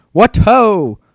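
shouted. Acted. Teacher in Roman armor shouting a battle cry. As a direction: call out like a knight charging. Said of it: "and shouted".

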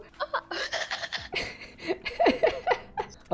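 long, breathy high-pitched laugh. Real, not acted. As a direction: dissolve into a squealing laugh. Laughs at the misunderstanding.

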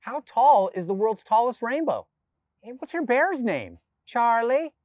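a hint of a wondering child; child's curious questions. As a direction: ask like a curious little kid.

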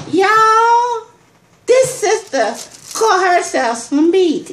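high, slow proud boast; excited brag. Real, not acted. Telling y'all about the beads, high proud boast. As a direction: crow about the catch.

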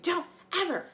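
high, loud furious scream; angry warrior refusing rescue. Acted. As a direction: scream a warning at him. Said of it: "Clarice screamed".

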